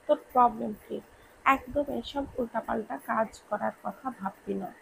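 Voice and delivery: usual speaking voice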